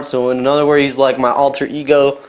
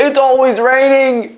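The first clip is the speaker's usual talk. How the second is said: mock British accent; 10 semitones higher, slower than usual. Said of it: "It's hard to cry in English".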